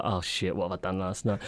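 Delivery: low, soft groan of regret; quiet hungover dread. Retold or imitated. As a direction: mutter it like a sore-headed morning after.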